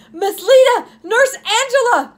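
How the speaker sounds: calling out high and loud; a scared girl crying for help; high, loud frightened call. A narrator's reading. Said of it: "cried out the frightened Julie".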